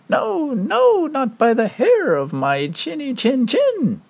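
very high squeaky pig; little pig voice. Acted.

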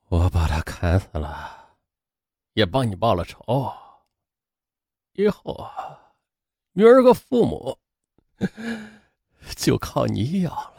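slow, very soft, spent voice; weak, drained. A narrator's reading. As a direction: barely get the words out between long pauses. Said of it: "有气无力地说". (有气无力，断断续续)

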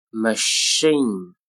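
"Machine" with a lengthened sh and ee; slow careful "Machine"; drawn-out model. Shown as a demonstration. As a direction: stretch "Machine" out for learners. Teacher models word slowly, then normally.

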